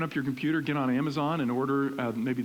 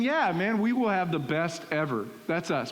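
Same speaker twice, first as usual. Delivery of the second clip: higher, competitive boast; mildly hyped-up bravado; 4 semitones higher than usual. Retold or imitated. Engaged man vowing the best marriage ever, playful bravado. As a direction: pump it up like an eager competitor. Recalls his own competitive thinking.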